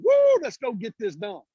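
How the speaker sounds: high, slow cheer; half-mocking woo cheer; mock hype. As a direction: cheer it out like a pep rally.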